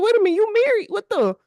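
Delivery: high-pitched mock disbelief; taken aback. Telling how they learned this dude was married, mock astonished. Retelling a startled reaction to news.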